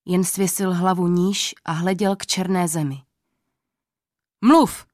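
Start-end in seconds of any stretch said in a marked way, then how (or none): 4.4-4.9 loud high furious command; king's shout. A narrator's reading. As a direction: bark the order at him. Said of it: "křičel na něj král". (král křičí na starce)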